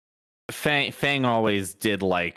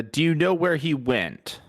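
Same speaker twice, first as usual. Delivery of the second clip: higher-pitched, formal in-character greeting; courteous adventurer; 6 semitones higher, slower than usual. Acted. Player asking a pirate about a missing friend, courteous raised voice. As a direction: address the pirate politely, pitched up.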